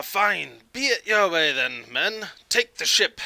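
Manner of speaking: high commanding order, building to a shout; disgruntled pirate captain. Acted. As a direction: give up parleying and order the attack. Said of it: "you see a disgruntled look".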